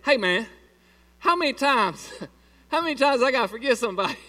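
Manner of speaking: voicing Peter, loud and high, fed up; Peter exasperated. Peter pleading with Jesus, exasperated loud and high. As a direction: throw up your hands as Peter. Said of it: "Peter says".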